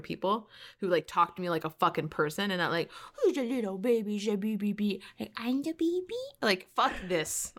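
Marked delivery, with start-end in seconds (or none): 3.2-6.0 put-on higher, sing-song baby talk, lightly done. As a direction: coo at an infant like a doting relative. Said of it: "my accent was baby talk".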